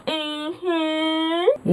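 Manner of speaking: high, long rising "mm-hmm"; mock-saucy knowing hum; suggestive hum. Suggestive aside at own innuendo.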